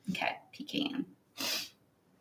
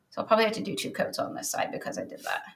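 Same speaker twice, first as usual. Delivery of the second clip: quiet aside; 9 dB quieter, faster than usual. Real, not acted. Quiet aside while painting.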